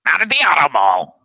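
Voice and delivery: high, loud car-ad pitchman; car dealer voice. Retold or imitated. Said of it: "he sounded like this".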